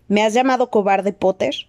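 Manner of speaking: plain reading voice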